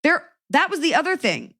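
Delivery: high indignant exclamation; incredulous rant; exasperated outburst. Real, not acted. Exasperated rant about bear shortage.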